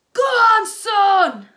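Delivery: very loud, high cheer; joyful shout. A narrator's reading. Dad cheering his son's winning goal. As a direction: bellow it in delight. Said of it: "shouted Dennis's dad".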